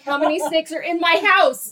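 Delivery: rising alarm at yet another snake; panicked. Retold or imitated. Mom finding a second snake at home, panicked cry.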